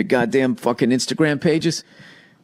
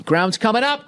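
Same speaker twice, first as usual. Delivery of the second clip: mock alarm; 9 semitones higher than usual. Mock falling selfie angle.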